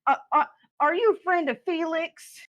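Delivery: high-pitched, hesitant question; lightly voiced sweet newcomer; tentative character greeting. Character meets a dwarf, addresses him.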